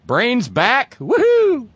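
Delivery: high excited whoop on woohoo; cheering chatter. Retold or imitated. Host reading a chat room cheer in an excited voice. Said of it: "in the chat room".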